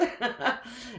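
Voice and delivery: brief self-mocking laugh; short laugh. Real, not acted. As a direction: chuckle at yourself. Laughs at herself.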